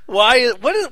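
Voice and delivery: ordinary delivery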